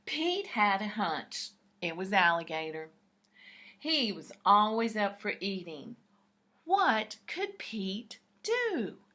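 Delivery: plain reading voice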